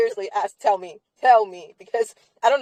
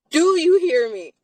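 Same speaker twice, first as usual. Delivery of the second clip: exasperated demand; 6 semitones higher, 3 dB louder than usual. Real, not acted.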